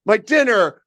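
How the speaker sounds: high, squawking mock scolding; hammed-up outrage. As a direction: yell like a chicken whose dinner got wrecked. Chicken scolds the Fanatic.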